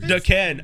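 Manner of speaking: "Daken" as duh-KEN, second syllable stressed; split-name mispronunciation. Retold or imitated. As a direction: imitate "Daken" as Da-KEN, like two words. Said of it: "doesn't have a dash between Da and Ken".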